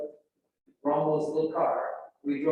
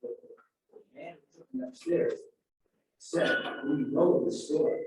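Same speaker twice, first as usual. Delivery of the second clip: ordinary talk, nothing marked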